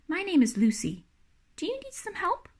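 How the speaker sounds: lightly voiced small girl, question lifting high; a shy child offering help; little girl Lucy. A narrator's reading. Small girl Lucy greets stranger.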